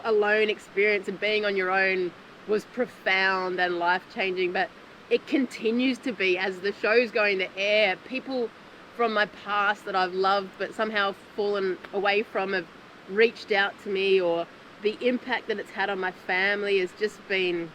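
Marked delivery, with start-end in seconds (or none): none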